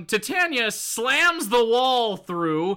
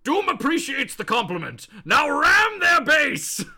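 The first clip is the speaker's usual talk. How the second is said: higher, pompous voice rising to a shout; hammed-up villain; 6 semitones higher than usual. Villain thanking underling then ordering attack, hammed-up shout.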